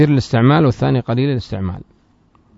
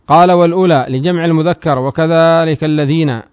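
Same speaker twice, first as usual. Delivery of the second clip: raised, louder recitation of the source text; reading the text aloud; 4 semitones higher, 5 dB louder than usual. Shown as a demonstration. Teacher reciting the grammar text to students, raised voice. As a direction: recite the matn line for the class.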